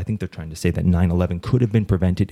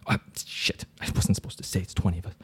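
whispered slip; 8 dB quieter than usual. Acted. Caller lets a secret slip.

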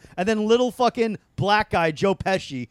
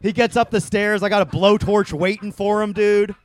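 loud, raised, worked-up boast; mock bragging; 4 semitones higher, 8 dB louder than usual. Comedian boasting how he'd win a fight, loud mock bravado. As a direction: boast at full volume, playing tough.

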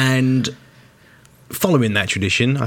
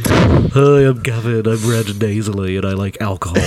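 nasal Gavin impression. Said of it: "I'm dead nasally".

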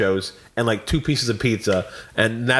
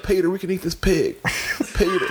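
higher put-on Clinton from Family Guy; hammed-up cartoon Clinton calling Peter; Bill Clinton impression; 6 semitones higher than usual. Podcaster recreating a Family Guy Clinton bit, higher voice. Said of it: "my Bill Clinton voice".

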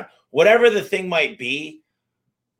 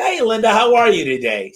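higher, upbeat customer-service hello; cheery phone greeting; 7 semitones higher, 4 dB louder than usual. Retold or imitated. Boss phoning a lady who needs a repair, cheery greeting. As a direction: greet the customer brightly, pitched up.

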